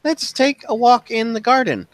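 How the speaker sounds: high-pitched lady's voice; Alex's character voice. Acted. Alex proposes a garden walk at tea time, high voice. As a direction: invite them along in a high voice. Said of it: "She's like".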